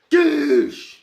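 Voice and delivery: high sharp goosh impact noise; cartoon punch whoosh; impact sound. Impact sound effect mid-fight.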